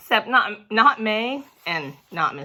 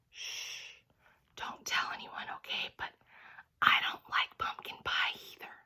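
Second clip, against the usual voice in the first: hushed, confiding whisper; secret whisper; 13 dB quieter than usual. A narrator's reading. Teacher whispering a secret to his class. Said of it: "he made his voice real secret".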